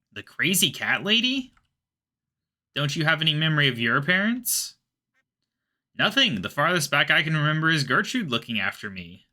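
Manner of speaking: game dialogue read-aloud. Acted. Cat confides lovesickness to friends, animated character read-aloud. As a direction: act out the quest dialogue with lively, lilting tunes.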